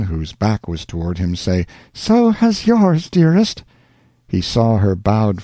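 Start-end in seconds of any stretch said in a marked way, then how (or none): 1.9-3.6 tender woman's voice. Acted. Rosanna's loving reply to Alonzo.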